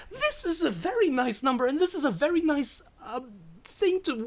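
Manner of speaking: high, loud delighted gushing; real thrill at the surprise; excited. Real, not acted. Thrilled by a donation.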